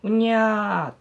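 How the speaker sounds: put-on high drawn-out baby wail; child's cry. Parent hearing a child over the monitor, imitated baby cry. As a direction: imitate a little child stirring awake. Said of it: "子供の声が聞こえた".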